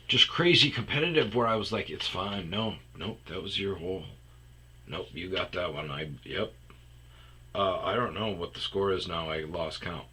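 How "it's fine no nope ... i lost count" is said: mumbled deflated reply. Retold or imitated. His own deflated turned-off reply.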